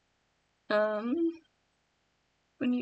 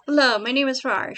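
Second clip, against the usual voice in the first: loud friendly greeting at usual pitch; first try at Rar's line; 2 semitones higher, 11 dB louder than usual. Shown as a demonstration. Writer trying out Rar's greeting, loud and friendly. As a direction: say Rar's hello loud and plain.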